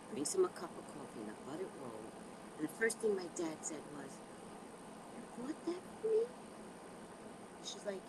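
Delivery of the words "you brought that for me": high, softened, disbelieving dad; touched surprise. Retold or imitated. Dad to daughter bringing coffee, touched and surprised. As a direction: lift it softly into an amazed question. Dad's surprised reaction acted out.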